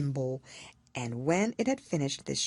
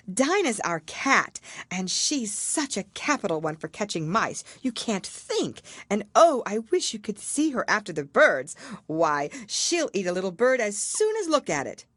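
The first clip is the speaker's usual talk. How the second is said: high, loud gushing chatter; excited girl boasting of her cat; eager Alice; 6 semitones higher, 4 dB louder than usual. A narrator's reading. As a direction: gush about your pet. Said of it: "Alice replied eagerly".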